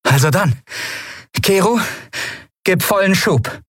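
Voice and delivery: a touch of bold command; Endriel, commanding. A narrator's reading. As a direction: give the go signal with verve. Endriel orders full thrust.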